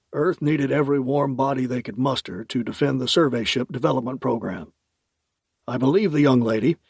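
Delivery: put-on amused older uncle; lightly voiced fond uncle; uncle Max voice.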